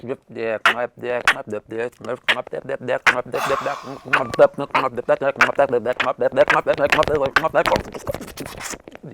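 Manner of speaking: words played in reverse, like a tape run backwards; backwards speech. Shown as a demonstration. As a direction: talk backwards like a reversed record. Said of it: "play it back, it sound like".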